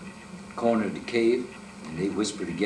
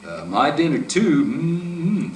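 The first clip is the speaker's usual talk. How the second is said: hungry bear; 4 semitones higher, 4 dB louder, slower than usual. A narrator's reading. Bear smacks his lips.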